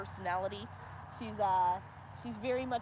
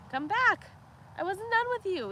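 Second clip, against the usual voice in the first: higher, louder mock steer call; voicing the steer; 10 semitones higher, 4 dB louder than usual. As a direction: call after dad as the steer. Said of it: "He's like".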